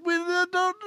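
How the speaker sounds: very high mock whimper; acted hysterical maid; tearful wail. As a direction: squeak it out through pretend tears. Distraught maid wails a protest.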